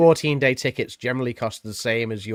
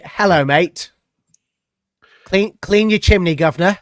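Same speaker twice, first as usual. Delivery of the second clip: lightly done Cockney, a bit higher, slow on the chimney line; Cockney accent demo; 6 semitones higher, 4 dB louder, slower than usual. Shown as a demonstration. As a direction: coach a friend through a mock London chimney-sweep line. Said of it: "I can't do the accent".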